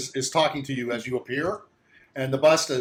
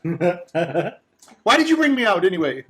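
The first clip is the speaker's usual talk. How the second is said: offended bust; 6 semitones higher than usual. Acted.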